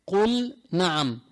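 قل نعم with the lam of قل clearly sounded; clear lam in qul na'am. Shown as a demonstration. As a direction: say قل نعم slowly, lam fully pronounced before نعم. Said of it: "ما بضغم اللام بالنون". (إظهار اللام في قل نعم)

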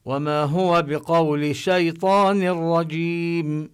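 chanted Quran verse, drawn out; Quran recitation. Acted. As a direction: recite the verse slowly and carefully.

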